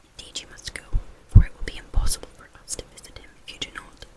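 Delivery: usual speaking voice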